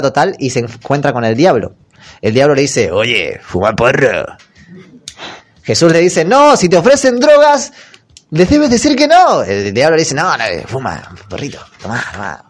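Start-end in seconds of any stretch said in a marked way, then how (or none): 2.9-4.3 slow, low devil voice; tempting devil. Acted. El diablo tienta a Jesús. 6.2-9.4 much higher, louder anti-drug lecture; preachy Jesus. Acted. Jesús rechaza drogas, sermón escolar. 10.1-12.4 much softer, lower coaxing; whispered temptation. Acted. El diablo insiste, ofreciendo.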